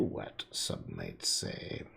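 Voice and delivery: deep mock aside.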